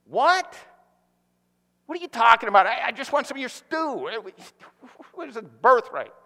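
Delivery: bewildered, he only wants soup; baffled. Acted. Pastor voicing Esau confused by the birthright demand, baffled.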